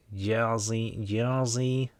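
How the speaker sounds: drawn-out mock Jersey accent, pitch climbing on the repeat; put-on Jersey accent. Host toying with a viewer's home state, put-on Jersey accent. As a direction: stretch the name in a hammed-up Jersey accent. Playing with the name's American sound.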